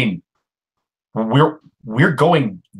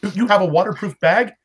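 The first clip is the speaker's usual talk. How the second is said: surprised; 7 semitones higher, faster than usual. Acted. Surprised question.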